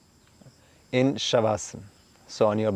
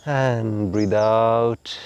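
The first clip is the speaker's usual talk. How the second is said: guided relaxation. Acted. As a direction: talk them gently into letting go.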